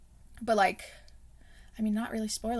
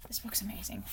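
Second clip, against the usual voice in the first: awed whisper; 9 dB quieter than usual. Real, not acted. Awed aside about favourite book.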